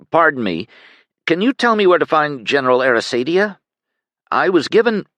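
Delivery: a stranger eagerly stopping a soldier on the street; polite Penn, asking. A narrator's reading.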